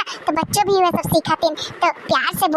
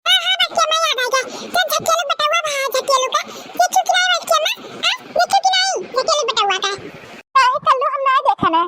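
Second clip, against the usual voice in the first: put-on high child voice; 8 semitones higher than usual. Child gossips about neighbour's boy.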